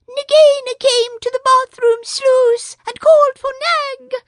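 a small proud bird boasting; Darzee the tailorbird. A narrator's reading.

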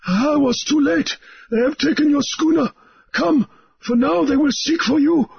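high, anxious warning; urgent islander. A narrator's reading. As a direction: press him to flee. Mauriri warns of danger.

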